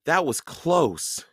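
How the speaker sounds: put-on slow, relieved young boy; a boy's voice, slow and relieved. A narrator's reading. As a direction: let it out slowly, glad it's over. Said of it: "Jack sighed".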